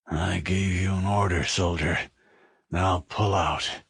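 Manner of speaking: weak, slurred, fading captain's voice. Acted. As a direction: barely get the order out, slurred. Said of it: "The voice was weak and sounded slurred".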